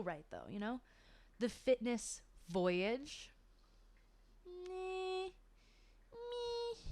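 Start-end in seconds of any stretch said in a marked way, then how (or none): 4.5-6.8 high, drawn-out meh; unimpressed singsong meh; dismissive meh. Real, not acted. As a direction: brush the word off with a sung meh. Dismissive verdict.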